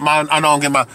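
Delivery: ordinary talk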